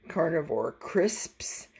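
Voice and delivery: slow soft whisper of the name; hushed label read. Real, not acted. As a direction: breathe the name out slowly. Whispered label reading in unboxing.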